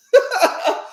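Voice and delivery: quick high laugh; short real laugh. Real, not acted. Laughs catching her breath.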